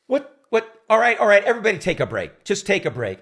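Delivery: high, loud call for a break; fed-up director. Retold or imitated. Director halting rehearsal for everyone, loud. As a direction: throw up your hands and call it. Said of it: "Hal says".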